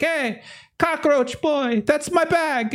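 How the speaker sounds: high, drawn-out falsetto scold; indignant woman chasing a thief; angry woman. Acted. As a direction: screech at him like an outraged lady.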